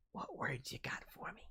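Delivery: breathy whispered ask. Real, not acted. Streamer whispers to chat for words.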